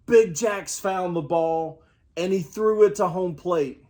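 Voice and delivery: usual speaking voice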